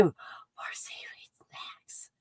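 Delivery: whispered, slow and soft with delight. Real, not acted. Vlogger thanks a viewer for snacks in a slow whisper. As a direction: breathe the thanks out in a hushed voice.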